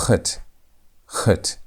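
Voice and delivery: "Chet" begun between K and H; guttural CH. Shown as a demonstration. Teacher models it for practice, guttural CH. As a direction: say "Chet" with the CH of Bach. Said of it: "practice on how to pronounce this letter".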